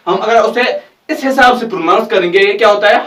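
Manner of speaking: ordinary delivery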